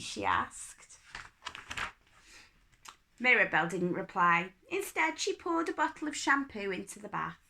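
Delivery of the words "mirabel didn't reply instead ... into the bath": usual speaking voice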